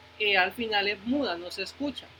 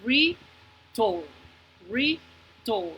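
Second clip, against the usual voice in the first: "return" in two well-separated beats, repeated; re, pause, turn, for each "return"; syllable by syllable; much slower than usual. Shown as a demonstration. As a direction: sound out "return" so each vowel is heard. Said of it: "¿cuántas vocales?".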